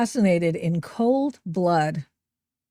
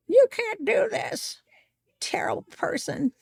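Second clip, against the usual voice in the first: mock screecher; 11 semitones higher, 4 dB quieter than usual. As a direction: parody the angry woman, then drop to a whisper. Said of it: "She yells at him".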